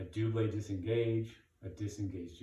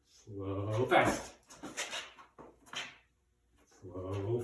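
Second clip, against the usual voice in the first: called slow, fast; 3 semitones lower, much slower than usual. Shown as a demonstration. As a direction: call the tempo, stretch slow, snap fast. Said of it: "Now I'm gonna say slow, fast".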